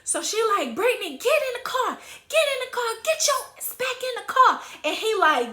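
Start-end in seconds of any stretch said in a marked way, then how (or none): 0.8-4.6 high-pitched imitation of a mother's order; exasperated mock-mom command; scolding mother. Mother ordering daughter, acted.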